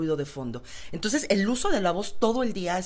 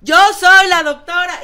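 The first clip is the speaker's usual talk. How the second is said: high, very loud shout; demonstrated full-voice yell; 11 semitones higher, 15 dB louder than usual. Shown as a demonstration. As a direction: yell it out at full force, pitched way up. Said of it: "Ahora grita".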